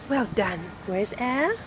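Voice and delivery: usual speaking voice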